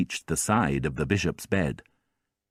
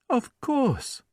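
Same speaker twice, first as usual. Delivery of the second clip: kindly bishop. A narrator's reading. Bishop reassuring.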